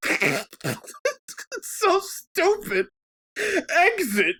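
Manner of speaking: slow, high, slurred mock disgust; drunk scoff. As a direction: drag it out like a drunk sneering at a sign. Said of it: "And I go".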